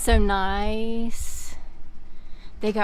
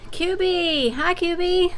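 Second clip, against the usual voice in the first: pet greeting; 8 semitones higher, slower than usual. Real, not acted.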